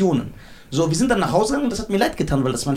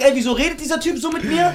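higher-pitched imitation of an aggrieved friend; mildly dramatic mock complaint, pitched up; dramatized quote; 7 semitones higher than usual. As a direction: act out his indignant question, a bit overdone. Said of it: "Du hast echt dramatisch".

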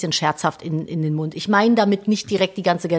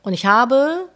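usual speaking voice

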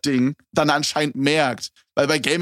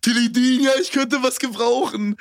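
[name]-Imitation; 10 semitones higher than usual. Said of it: "diese [name] und [name] Schiene".

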